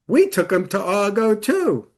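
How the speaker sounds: brother's high-pitched surprised echo; acted astonishment. Brother discovering Dad saw the same movie, astonished. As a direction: retell it with comic disbelief, voice lifted.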